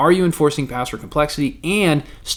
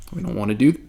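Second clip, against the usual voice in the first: talking through hiccups; hiccuping mid-sentence; 2 semitones lower, faster than usual. Real, not acted. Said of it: "if it looks like I'm hiccuping, um, I kind of am".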